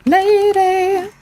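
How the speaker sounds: high, loud gleeful alarm; excited shout. Real, not acted. Gamer finding the ladybug and shouting an alert, high and gleeful. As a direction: raise the alarm, thrilled.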